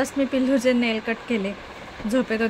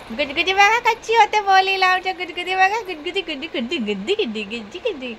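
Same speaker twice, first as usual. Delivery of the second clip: playful tickle talk; 6 semitones higher, 3 dB louder, faster than usual. Real, not acted.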